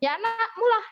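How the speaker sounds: wife teasing annoyed husband, playful high retort. Retold or imitated. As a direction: tease him back. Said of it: "Saya bercanda-bercanda".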